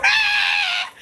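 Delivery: very high-pitched mock scream; mimicked scream. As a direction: let out a piercing imitation shriek. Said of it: "they start to scream".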